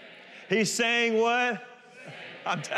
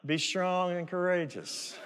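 limp, unenthusiastic; 5 semitones lower, 5 dB quieter than usual. Retold or imitated. Mocking churchgoers who answer without any conviction, muttering. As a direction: lower and softer, mumbled with no emphasis. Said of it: "Some are like".

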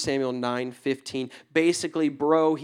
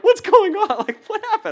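high, startled disbelief; surprised friends; 9 dB louder than usual. Retold or imitated. Friends finding him praying mid-road, high startled shock. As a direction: friends gaping at what they see.

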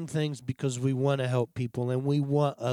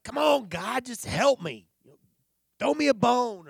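mock pleading; 9 semitones higher than usual. Pleading with God, retold.

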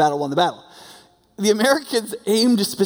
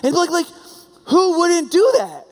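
laughing disbelief; 11 semitones higher, 4 dB louder than usual. Real, not acted. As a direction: laugh at how obvious it is. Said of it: "incredulous aside".

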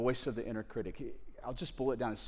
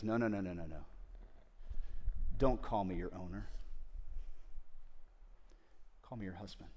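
tender God voice; 5 semitones lower, 6 dB quieter than usual. Acted. God voiced tenderly rejecting "owner.

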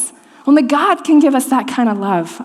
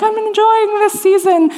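bright, much higher mock-chirpy voice; mock cheerful quote; 11 semitones higher than usual. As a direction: chirp it like you're loving every minute. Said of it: "I'm like".

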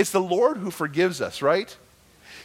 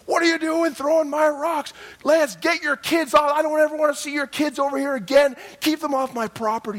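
high, loud furious yell; angry yelling neighbor; 11 semitones higher, 4 dB louder than usual. Retold or imitated. Neighbor Sam yelling at Lance over thrown rocks, furious high shout. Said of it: "and Sam lost it".

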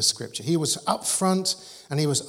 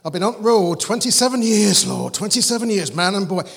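loud, higher Yorkshire praying voice; Yorkshire accent; 5 semitones higher, 8 dB louder than usual. Retold or imitated. Bill from Kent praying to the Lord, imitated Yorkshire accent. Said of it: "in a Yorkshire accent".

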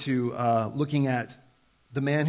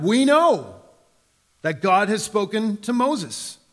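higher and louder, "know" punched, slow; smug certainty; 6 semitones higher, 6 dB louder, slower than usual. Acted. Preacher voicing Pharisees sure of God's word, smug. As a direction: proclaim it like a know-it-all.

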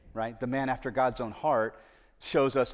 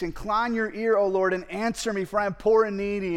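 psalm reading; 8 semitones higher, 6 dB louder than usual. Shown as a demonstration. Reading David's prayer aloud.

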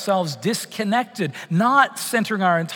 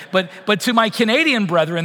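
ordinary delivery

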